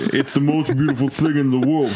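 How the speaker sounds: a hint of a breathy Vin Diesel; lightly voiced tough action star; Vin Diesel impression. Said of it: "I don't think you're reading this like Vin Diesel would say it".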